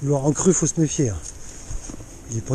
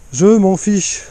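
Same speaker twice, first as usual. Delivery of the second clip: louder, higher "je m'en fiche"; emphatic; 4 semitones higher, 5 dB louder, much slower than usual. Real, not acted. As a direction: repeat it with force and conviction.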